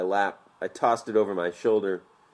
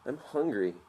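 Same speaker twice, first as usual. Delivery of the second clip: whining; 6 semitones higher, 4 dB quieter than usual. A narrator's reading. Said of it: "Scott whined".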